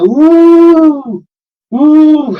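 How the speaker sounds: loud high hooting like a stadium crowd; imitated crowd hooting. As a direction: whoop it out like the crowd. Crowd cheering retold.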